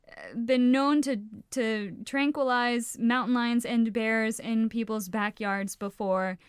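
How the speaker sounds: ordinary delivery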